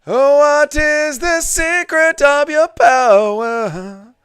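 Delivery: high, slow, theatrical announcer voice; mock grand voice. Streamer hamming up a fighter's nickname in a grand voice. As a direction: stretch it out like a booming oracle. Mock dramatic voice reading name.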